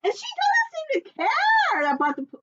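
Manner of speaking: very high, loud, slow exclamation; alarmed cry. Acted. Puffin telling friends the queen is dangerous, shrieking. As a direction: shriek it in shock. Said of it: "said Puffin Muffin".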